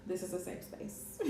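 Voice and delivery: soft breathy giggle through words; laughing whisper. Retold or imitated. Quoting her own group post.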